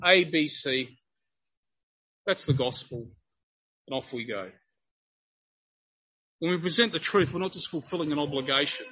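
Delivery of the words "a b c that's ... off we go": low, detached list with gaps between items; perfunctory recital. Acted. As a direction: tick off the points as if unmoved, dropping away. Mimics merely disseminating some facts.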